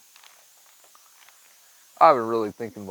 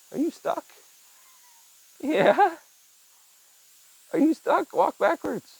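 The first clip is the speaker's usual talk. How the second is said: high rising pet voice; high calling pet talk; talking to the cat; 3 dB louder, slower than usual. Real, not acted. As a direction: call up to the cat in a squeak.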